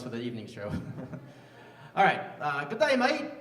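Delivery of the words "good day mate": high-pitched Australian greeting; Australian accent. Shown as a demonstration. Comic having the crowd guess his accent, Australian accent. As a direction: call it out in a put-on Australian accent.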